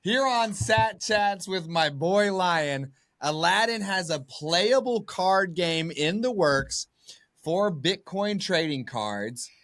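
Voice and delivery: slow, high hype announcer; drawn-out hype announcement. Acted. Hyped exclusive announcement.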